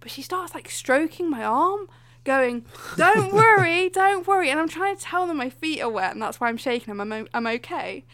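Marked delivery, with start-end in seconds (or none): 3.0-4.5 higher, louder mock cooing; soothing dentist. Dentist strokes the patient's arm, mock cooing. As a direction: coo it like a hammed-up comforter.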